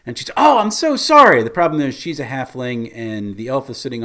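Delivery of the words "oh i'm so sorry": high, loud fake-clumsy apology; feigned apology. Halfling pickpocket faking a fall onto her mark, loudly apologetic. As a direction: exclaim a big put-on sorry. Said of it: "pulls the old trip".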